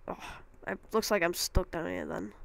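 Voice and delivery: ordinary delivery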